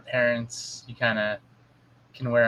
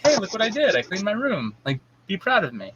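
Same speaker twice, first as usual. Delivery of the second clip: higher-pitched imitation of a proud kid; excited child; 6 semitones higher than usual. Daughter showing parent her cleaned room, excited. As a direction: mimic a child eager for praise.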